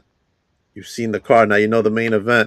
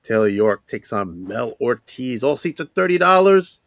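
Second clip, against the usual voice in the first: hype promo read; 5 semitones higher than usual. Acted. Hyped event promo read.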